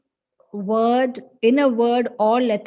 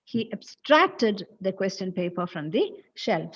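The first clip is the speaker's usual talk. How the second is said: "abstracted" with a strong, raised STRACT; stress on second syllable. Shown as a demonstration. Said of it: "there is a change in the stress".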